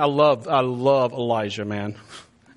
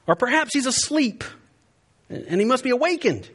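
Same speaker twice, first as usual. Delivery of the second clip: high-pitched sly taunt; mocking; 8 semitones higher than usual. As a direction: tease them in a high voice. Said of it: "Elijah mocked them".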